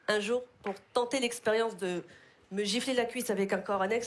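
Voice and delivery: ordinary talk, nothing marked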